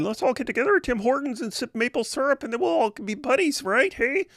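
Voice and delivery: high, mock Canadian accent with an eh. Retelling Peterson's outreach, mocking it in a high Canadian accent. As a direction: ham up a Canadian nice-guy accent.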